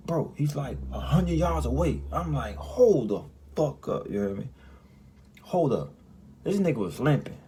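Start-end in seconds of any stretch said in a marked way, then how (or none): 2.6-4.1 loud, slow and high; shocked disbelief. Retold or imitated. Sees limping man far away. 5.4-5.9 surprised. Real, not acted. Repeats in telling.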